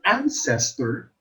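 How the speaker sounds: "ancestor" as AN-ces-tor; teacher's demo of "ancestor", accent on the first syllable; first-syllable stress. Shown as a demonstration. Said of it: "the first syllable is accented".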